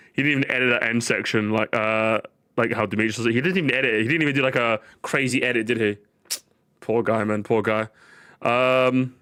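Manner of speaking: ordinary delivery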